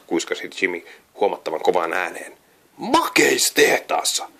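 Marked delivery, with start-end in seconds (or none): 2.8-4.3 slow, savouring little bird voice; drawn-out awe. A narrator's reading. Jami adds a correction, slow and awed. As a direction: stretch the word out dreamily. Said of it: "Jami lisäsi".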